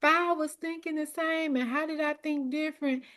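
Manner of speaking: high, mildly acted self-doubt; doubting group member. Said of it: "other people's like".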